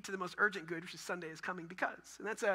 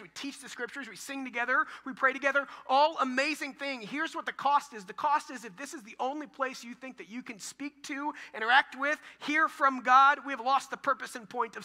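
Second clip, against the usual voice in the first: rising, louder preacher's build; preaching at full force; 6 semitones higher, 9 dB louder than usual. Acted. Pastor drives home his point to congregation, preaching loud. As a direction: preach it higher and louder, pushing each phrase.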